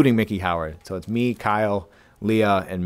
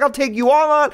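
high, imitated fighting talk; playfully acted belligerence; mock challenge. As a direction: dare them all, hammed up. Acts girlfriend challenging the car.

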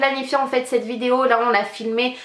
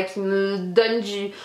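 usual speaking voice

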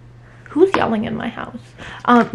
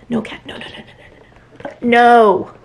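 scolding the cat; 4 dB louder than usual. Real, not acted. Shooing cat off the boxes.

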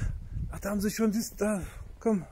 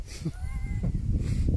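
high squealing laugh. Real, not acted. Laughing after the joke.

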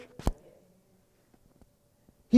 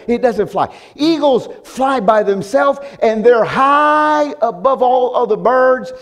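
loud, high rhythmic refrain; full-force preaching; 6 semitones higher, 4 dB louder than usual. Acted. Pastor rousing the church about eagles, full-force preaching. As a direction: hammer each eagles line home.

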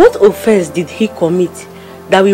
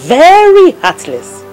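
long, high, loud "very"; emphatic "very"; 10 semitones higher, 5 dB louder, slower than usual. Acted. Friend condemning heartless devil, stress on very. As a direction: stretch VERY in indignation.

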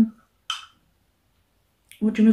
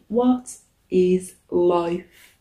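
drawn-out; much slower than usual. Acted. Slow drawn-out repeat.

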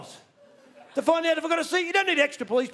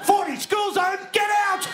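mock yell; 9 semitones higher than usual. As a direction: yell it like a frantic driver. Yelling speed signs at driver.

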